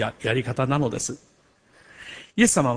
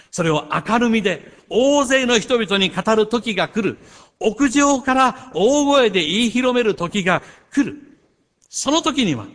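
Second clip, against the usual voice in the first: rising fervent proclamation; 7 semitones higher, 4 dB louder than usual. Real, not acted. Preacher proclaims the coming day.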